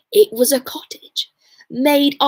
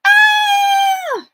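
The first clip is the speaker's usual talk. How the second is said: high loud startled scream; scream of fright; 6 dB louder than usual. Acted. The children startled by the creaking door, screaming.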